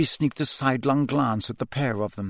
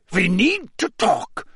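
put-on high, slow, accented character voice; abrupt Karkaroff; 7 semitones higher, slower than usual. A narrator's reading. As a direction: demand it, stressing each word. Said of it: "said Karkaroff abruptly".

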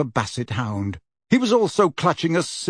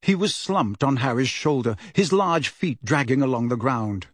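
ordinary delivery

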